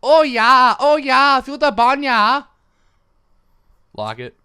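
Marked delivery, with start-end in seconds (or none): 0.0-2.4 high, loud, slow meathead Aussie; mock Australian accent. Shown as a demonstration. Showing off a mock Aussie accent, loud and dumb. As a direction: ham up a dim gym bro. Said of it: "I'm from Australia".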